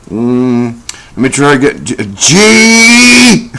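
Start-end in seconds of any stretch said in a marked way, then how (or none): 2.1-3.5 much higher, louder burst on the letter; a kid finally getting the first letter right; triumphant kid. Retold or imitated. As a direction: blurt the letter out like a kid sure he got it. Kid shouting spelling attempt.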